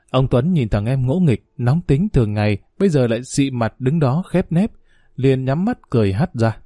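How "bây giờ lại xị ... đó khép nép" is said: usual speaking voice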